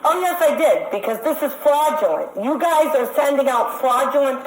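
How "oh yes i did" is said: ordinary delivery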